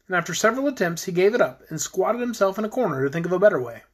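usual speaking voice

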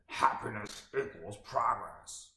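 lightly voiced Tony Robbins, slow and gravelly; slow growl, a hint of Tony Robbins. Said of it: "in his gravelly classic voice".

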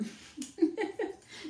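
high breathy laugh; real burst of laughter. Real, not acted. Groomer laughing at the poodle story.

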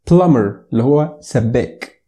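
ordinary talk, nothing marked

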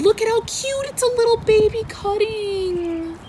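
high-pitched coo; cutesy excitement. Real, not acted. Plant lover showing viewers a baby cutting, high cutesy squeal. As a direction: squeal over something tiny and adorable. Cooing over a tiny new cutting.

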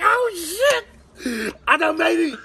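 high, loud, laughing-crying burst of joy; like a dream just came true; overjoyed. Retold or imitated. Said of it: "I'm like, in myself".

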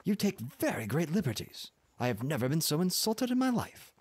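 high-pitched indignation; indignant outburst without shouting; angry cry. A narrator's reading. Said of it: "I cried angrily".

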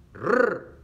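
loud rolled trill on "Rrr"; R fuerte. Shown as a demonstration. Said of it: "Al principio suena fuerte". (erre fuerte y vibrada en "Rrr")

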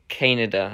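Canada as KAN-uh-duh, first syllable stressed; redub pronunciation. Shown as a demonstration. Telling viewers the redub's name, Canada stressed up front. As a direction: stress the opening of Canada, short middle. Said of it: "redub, it's".

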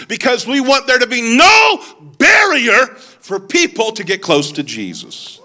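high-pitched, rousing pulpit delivery; impassioned preaching. Real, not acted. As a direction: drive the point home to the congregation. Preaches at full force.

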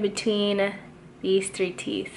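talking with finger in mouth; finger pulling lip down. Real, not acted. Seen 1: Repeatedly pulls lower lip down with finger, showing braces while talking.

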